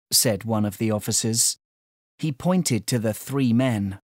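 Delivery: ordinary delivery, nothing marked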